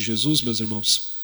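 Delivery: loud praise to God; fervent prayer. Real, not acted. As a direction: pray out with fervor. Closing prayer with music.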